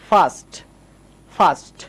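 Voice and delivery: ফার্স্ট as "faast", long AH, no R; AH vowel. Shown as a demonstration. Teacher models A before S-T, AH vowel. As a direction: say ফার্স্ট on its own with open AH. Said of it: "এই লেটারকে আ পড়ব".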